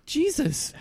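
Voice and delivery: shocked. Acted. Shocked accusation after explosion.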